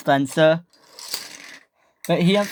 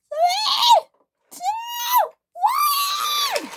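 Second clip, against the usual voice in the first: very high, spaced-out screamed count; shrieked countdown; much slower than usual. A narrator's reading. Millie backing up for the hill, screamed countdown. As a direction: scream each number separately.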